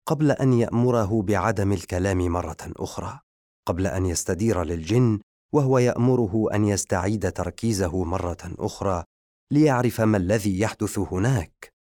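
ordinary delivery, nothing marked

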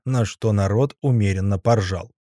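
usual speaking voice

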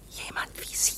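voiceless hushed whisper; sly whisper. Acted. Clerk whispers that K should kill the student. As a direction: breathe the suggestion, hidden. Suggesting murder secretly.

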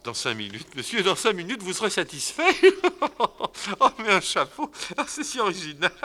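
very high, giggling through the words; laughing baroness. Acted. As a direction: titter with delight. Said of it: "Elle s'en va en riant".

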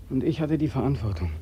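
low and soft, weighed down; heavy quiet admission. Acted. Soldier admits to his colonel he lost eleven men, low and soft. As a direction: let the words sink, barely voiced.

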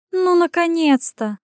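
high, slow exasperated exhale; exasperated sigh. Acted. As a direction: breathe it out, eyes rolling. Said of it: "выдохнула Ирен".

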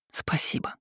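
soft breathy whisper of thanks. A narrator's reading. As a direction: barely whisper it. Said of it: "прошептала я".

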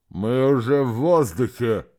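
strained speech behind a mask; firefighter air mask. Acted. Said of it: "сдавленный голос, словно его обладатель говорил сквозь маску".

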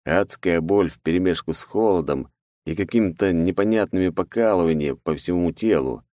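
usual speaking voice

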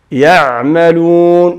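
slow يعملون, ayn given moderate length; in-between ayn. Shown as a demonstration. Teacher demonstrating a silent-vowelled ayn, slow in-between ع in يعملون. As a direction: let the ع in يعملون sound, drawing the word out. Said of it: "فهي بين بين".

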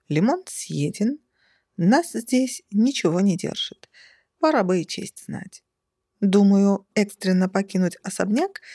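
ordinary delivery

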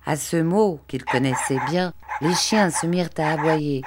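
ordinary delivery, nothing marked

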